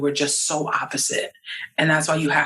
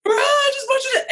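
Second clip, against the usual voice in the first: hammed-up frustrated husband pleading; exasperated husband. Husband voiced in retold fight.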